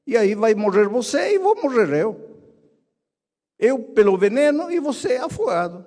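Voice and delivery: a frightened little frog protesting; frog's voice. Acted. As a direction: frog refusing, rising in alarm. Frog replies to scorpion in fable.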